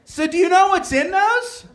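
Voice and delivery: high, pointed wife question; mock wife voice. Wife quizzing husband over the bag, mock high voice. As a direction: play the knowing wife catching him out. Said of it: "she's like".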